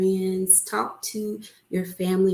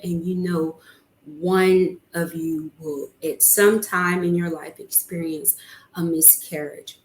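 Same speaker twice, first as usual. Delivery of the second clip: ordinary delivery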